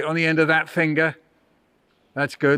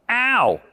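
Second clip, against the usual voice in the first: mock ow; 6 semitones higher than usual. Poem about frozen tongue.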